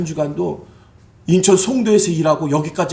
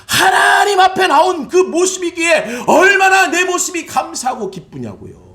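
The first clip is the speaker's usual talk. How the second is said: fiery preaching; 12 semitones higher, 5 dB louder than usual. Acted. Pastor urging the congregation to joy, fiery preaching. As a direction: proclaim it to the whole church.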